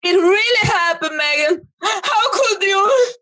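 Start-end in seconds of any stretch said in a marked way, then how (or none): 1.8-3.1 hammed-up tearful wail, slowed; mock crying. Madam sobbing at Megan for doubting her. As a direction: cry out betrayed, overdone.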